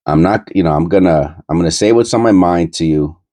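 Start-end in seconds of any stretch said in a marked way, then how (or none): none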